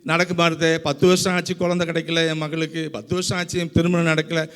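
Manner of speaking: imitated weary complaint, pitched low; mock lamenting. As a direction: grumble through a list of woes in a low monotone. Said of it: "இதே புலம்பல் தான்".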